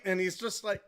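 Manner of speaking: usual speaking voice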